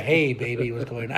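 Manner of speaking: lightly voiced low, suave sweet talk; a hint of a smooth seducer; low, mock-sexy come-on. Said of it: "a real sexy voice".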